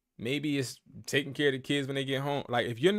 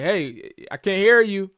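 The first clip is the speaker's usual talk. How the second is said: louder, higher and slow, straining to be heard; calling out; 7 semitones higher, 10 dB louder than usual. Retold or imitated. Mentor on a call with a whispering student, calling out loudly. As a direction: call out so she can hear you. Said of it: "I'm like".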